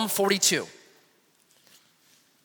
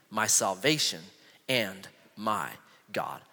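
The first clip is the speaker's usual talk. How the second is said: scripture reading; 3 semitones lower than usual. Acted. Reads psalm verses aloud.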